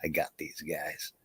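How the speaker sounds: low, quiet Texan aside; talking to himself. Acted. Texan sure he'll win, quietly to himself. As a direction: keep it down, just for yourself. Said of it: "to himself".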